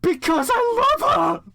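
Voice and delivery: very high, loud shriek. Acted. Draco defending Ebony to the teachers, high loud shriek. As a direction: wail it out desperately. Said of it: "Draco shrieked".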